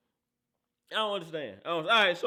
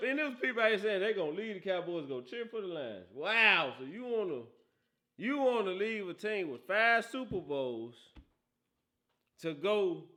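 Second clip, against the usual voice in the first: usual speaking voice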